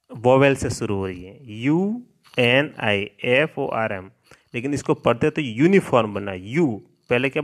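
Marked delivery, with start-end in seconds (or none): 1.5-4.1 slow letter-by-letter spelling; spelled out slowly. Shown as a demonstration. Teacher spelling a word slowly for pupils. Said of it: "अब इसमें देखो spelling".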